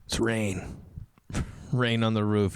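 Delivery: usual speaking voice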